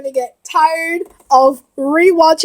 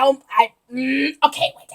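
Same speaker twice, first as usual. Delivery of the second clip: ordinary talk, nothing marked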